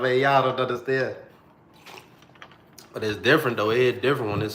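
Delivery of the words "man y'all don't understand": mock grieving whine. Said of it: "I'm talking about".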